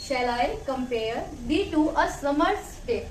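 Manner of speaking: very slow, measured "Shall I compare thee to a summer's day?" with gaps between feet; scanned verse reading. Shown as a demonstration. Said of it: "poem की line है ये ऐसे है".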